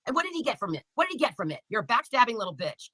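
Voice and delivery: ordinary delivery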